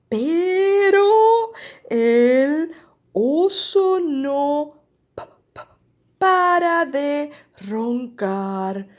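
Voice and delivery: very slow, stretched storybook refrain, starting high and loud; big, slow, teasing narration; drawn-out suspense. A narrator's reading. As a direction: stretch every word for a listening child.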